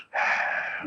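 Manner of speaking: a soft, heavy sigh before answering; weary sigh. Acted. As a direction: let out a tired breath. Duke asked about ransom note.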